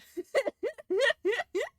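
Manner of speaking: sudden high giggle; high laugh. Real, not acted. Laughs at cold house.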